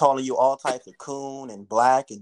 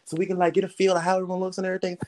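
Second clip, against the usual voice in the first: imitating the teacher; 7 semitones higher than usual. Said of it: "he was like".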